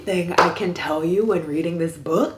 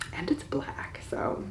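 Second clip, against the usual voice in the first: whisper; 2 semitones lower, 10 dB quieter than usual. Real, not acted. Whispering the title teasingly.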